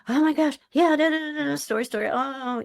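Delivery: flustered rush. Retold or imitated. Father on the phone rattling off his son's hospital story, high and hurried. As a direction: babble it all out in a fluster. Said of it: "And he".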